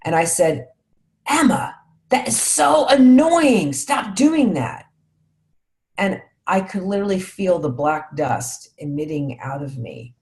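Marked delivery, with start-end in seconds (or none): 1.3-4.9 put-on higher, louder, slow irritated scold at the kid; reenacting snapping at her noisy daughter while parking, irritated; annoyed scolding. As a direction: snap at your daughter in the car, drawn out and exasperated. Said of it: "why did I say it in that tone of voice".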